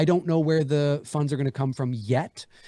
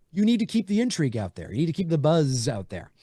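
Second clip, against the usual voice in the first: usual speaking voice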